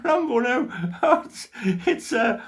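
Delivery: slow words through a laugh; chuckling as the tale ends. Real, not acted. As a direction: laugh it off, long ago. Laughing at his own story.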